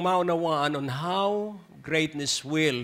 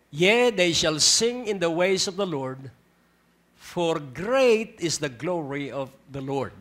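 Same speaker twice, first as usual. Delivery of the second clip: scripture reading; 2 semitones higher than usual. Acted. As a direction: proclaim the verse with weight. Reads scripture verse aloud. (mabagal at taimtim na pagbasa ng talata)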